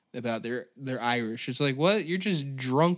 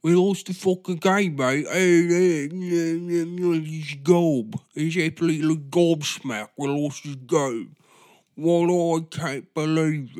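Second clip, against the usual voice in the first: mock English football fan, louder; hammed-up English lad, louder; 2 semitones higher, 6 dB louder than usual. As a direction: shout like a drunk lad after the match. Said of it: "because they're like".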